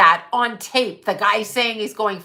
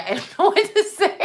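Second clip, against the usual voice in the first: laughing; 11 semitones higher, 3 dB louder than usual. Real, not acted. Repeated line with laughter.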